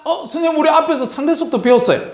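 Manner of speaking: mock student piping up high and fast; imagined student answering. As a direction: lightly play a pupil blurting the answer, high-pitched. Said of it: "그러면 애들 또 눈만 껌뻑껌뻑거리고 있다".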